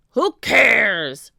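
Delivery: loud rough pirate; roar. Acted. Captain roars at Annie, loud. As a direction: roar dismissively. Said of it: "roared Captain Bones".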